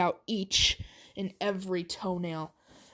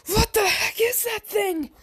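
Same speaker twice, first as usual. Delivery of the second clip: shrill high yell of fright; high startled shout; high panicked scream; 13 semitones higher, 4 dB louder than usual. Acted. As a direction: scream it in alarm, pitched way up. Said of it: "As Deku would scream".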